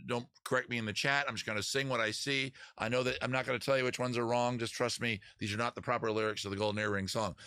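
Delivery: normal talking voice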